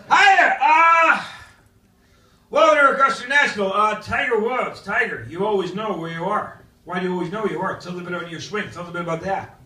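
hammed-up golf interviewer; Butch Harmon interviewer. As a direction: gush at Tiger like a coach on air. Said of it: "by Butch Harmon".

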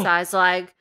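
normal talking voice

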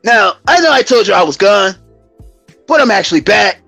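ordinary talk

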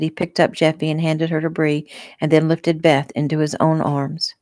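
ordinary delivery, nothing marked

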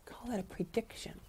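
unvoiced stage whisper. Shown as a demonstration. Teacher whispering an aside to the children. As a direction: whisper it to the kids.

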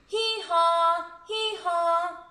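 loud, high drawn-out hee-haw; donkey bray. Acted. Donkey braying at the window.

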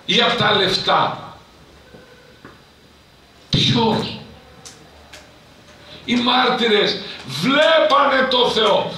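loud, high fiery preaching; preaching at full force. Acted. Priest rousing the congregation to seek God, in loud fiery preaching.

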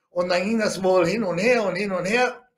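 ordinary delivery

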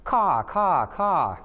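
loud, high cawing like a crow; croaking crow caw. A narrator's reading. Crows in the cornfield call at the geese, loud croaking caws.

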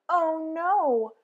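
ordinary delivery, nothing marked

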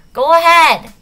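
loud high call up the chute; shout. Acted. Girl shouting up the chute to the others. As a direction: yell it up to them. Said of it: "and shout".

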